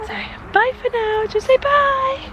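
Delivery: high sing-song goodbye; baby talk. Acted. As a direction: coax the baby to say bye. Prompting baby to wave.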